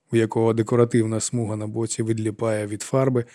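usual speaking voice

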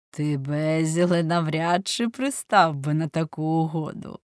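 plain reading voice

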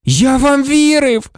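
high, agitated cry of betrayal; furious, overwrought accusation; voice breaking. A narrator's reading. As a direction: cry out slowly, shaking with fury. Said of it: "Його голос зривався від хвилювання".

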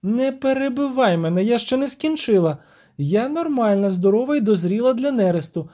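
high-voiced siren, reproachful; indignant siren. Siren scolds her lover for sacrificing nothing, high reproach. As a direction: complain in a high, lilting voice.

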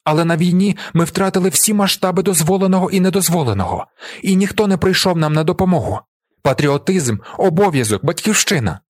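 high, urgent appeal to jurors; impassioned shouted plea. A narrator's reading. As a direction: plead for a comrade with conviction. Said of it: "кричу я".

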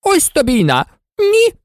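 high-pitched exclamation of dismay; taken aback. A narrator's reading. King reacting to Alice's question, startled exclamation. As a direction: exclaim in disbelief, jumping up in pitch.

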